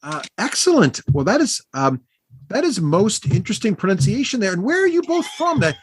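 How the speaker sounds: high-pitched, effusive halfling greeting; cheery halfling bard. Acted. Halfling bard thanking new friends for a drink, high effusive voice. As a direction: play a gushing little bard, pitched high.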